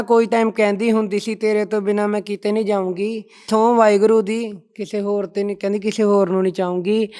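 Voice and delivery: loud, declaimed heartbreak verse; shayari recitation. Shown as a demonstration. Guest performing his breakup verse for the host, loud shayari recital. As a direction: project each line like a street poet, easing off on the last. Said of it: "ਸੁਣਾਓ ਕੀ ਲਿਖਿਆ ਹੈ".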